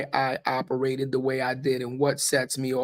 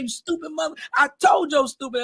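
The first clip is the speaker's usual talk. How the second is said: mom scolding; 12 semitones higher than usual. Retold or imitated. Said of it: "they popped me".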